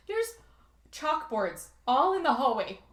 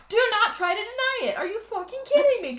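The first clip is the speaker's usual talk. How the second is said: loud, high-pitched mock fury; imitated indignant outburst; outraged principal; 11 semitones higher, 7 dB louder than usual. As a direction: yell it like an incensed principal. Acting out furious principal.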